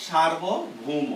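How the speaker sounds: "Sarvabhauma" drawn out slowly, part by part; slow word repetition. Shown as a demonstration. Teacher repeating the word written for the class, drawn out slowly. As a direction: say "Sarvabhauma" very slowly, in two separated parts.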